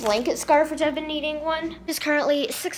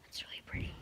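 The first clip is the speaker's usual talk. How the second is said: soft unvoiced whisper to the camera; hushed vlog whisper; 18 dB quieter, faster than usual. Real, not acted. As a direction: whisper so no one nearby hears. Whispering while out shopping.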